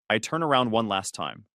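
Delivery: ordinary delivery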